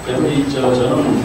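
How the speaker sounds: ordinary talk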